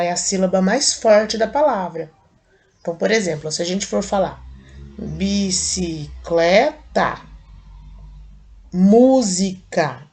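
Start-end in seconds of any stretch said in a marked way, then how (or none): 5.0-10.1 "bicicleta" and "música" drawn out slowly, punching "clé" and "mú", with "música" much louder; stressing the strong syllable. Shown as a demonstration. Teacher repeating the tonic-syllable demo for the kids, slow and emphatic. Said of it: "fala elas com um pouquinho mais de ênfase".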